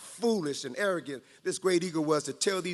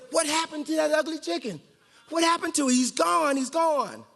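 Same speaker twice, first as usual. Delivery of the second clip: mock chicken voices; 8 semitones higher than usual. Chickens wondering aloud.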